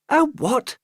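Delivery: put-on high, puzzled little girl; a child asking in a high voice; high girl's voice. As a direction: squeak it out puzzled. Daisy asks.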